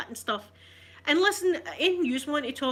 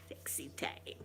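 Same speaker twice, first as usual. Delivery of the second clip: whisper; 13 dB quieter than usual. Acted. Cheeky aside to viewers.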